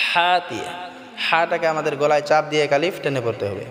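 ordinary delivery

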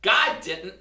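loud mocking triumph; gloating shout. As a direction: crow over her, gotcha. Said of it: "jumped out from behind the bushes and shouted".

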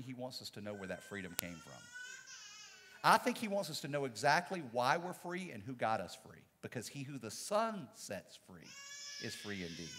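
soft, low, voice breaking with tears; sincere, close to crying; choked up. Real, not acted. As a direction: let the tears come through the words.

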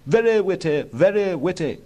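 high-pitched royal approval; approving Prince. A narrator's reading. Prince claps Oscar on the shoulder, approving. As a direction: repeat the praise in a high voice.

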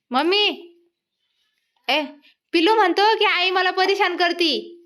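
high, loud sing-song scolding of a little one. Real, not acted. Mother scolds toddler after fall. (लहान बाळाला मोठ्याने, उंच आवाजात लाडिक रागावणे)

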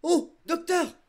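high, loud call for help; loud, high-pitched alarm; frantic cry. Acted. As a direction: cry out desperately to the doctor. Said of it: "avons-nous crié".

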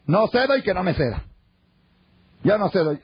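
high-pitched defiant husband; stubborn refusal. Acted. Fed-up husband refusing to give way to his wife, stubborn.

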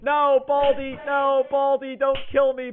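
ordinary talk

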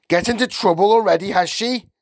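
high, loud, quick challenge; brusque father. A narrator's reading. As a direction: fire it at the teacher, high and loud. Gruff father answers the door.

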